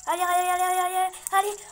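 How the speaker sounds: high, loud allez yells; frantic high shouting at the finish. Real, not acted. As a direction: scream her home to the line. Final sprint cheering.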